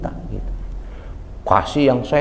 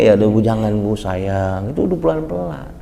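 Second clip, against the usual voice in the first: gentle coaxing; 7 semitones lower than usual. Retold or imitated. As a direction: coax the old lady tenderly. Gentle guide to old woman.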